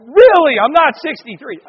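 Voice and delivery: loud, high exasperated retort; indignant echo. Retold or imitated. Speaker indignant at parents' mistake, loud high retort. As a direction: throw it back loudly, falling from high. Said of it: "I go".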